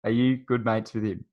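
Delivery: ordinary delivery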